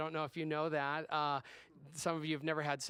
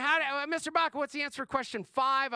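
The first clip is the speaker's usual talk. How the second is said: higher, louder mock pupil voice; imitated schoolkid; 7 semitones higher, 4 dB louder than usual. Pupil asking the substitute teacher for an answer, mock-kid voice. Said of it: "the kids were asking me".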